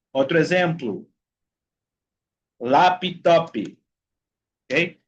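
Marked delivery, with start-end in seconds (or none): 2.6-3.5 laptop as "lapi-topi"; added final I. Shown as a demonstration. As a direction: say laptop Brazilian-style, an i after each p. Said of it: "agregarle una I a la sílaba".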